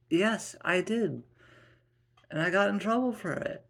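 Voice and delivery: high-pitched, defensive retort; defensive wife. Acted. Wife answering husband about her own tantrums, defensive.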